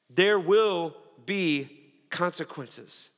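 slow and deliberate, each word weighed; a preacher warning the congregation; solemn warning. Acted. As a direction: let it land heavily.

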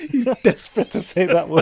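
high, loud, laughing tease. Real, not acted. Ribbing the co-host over a banned word, laughing. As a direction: tease through real laughter.

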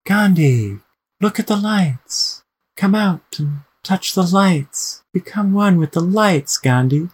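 slow, high, drawn-out luring call across water; eerie drifting voice. Acted. Voice from the lake luring Gandhi to the lights, eerie and drifting. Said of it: "a voice, uh, drifts across the lake".

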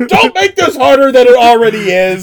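high, loud pleading teacher voice; exasperated teacher. Retold or imitated. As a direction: shout it like a fed-up teacher.

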